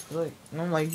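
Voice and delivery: ordinary talk, nothing marked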